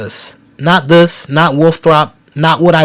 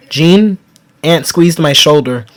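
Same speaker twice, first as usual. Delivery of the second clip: ordinary delivery, nothing marked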